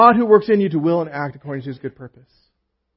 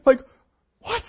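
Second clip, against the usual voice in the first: laughing through the words; 7 semitones higher, 5 dB louder than usual. Real, not acted. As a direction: chuckle at the letdown.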